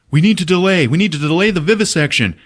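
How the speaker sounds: high, loud acted urgency; urgent demand. Agent bursting into the boss's office, loud high urgency. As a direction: burst in with an imitated urgent plea.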